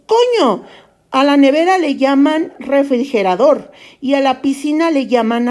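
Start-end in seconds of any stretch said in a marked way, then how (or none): 0.1-0.6 Spaniard exclamation. Acted. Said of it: "solo porque decimos".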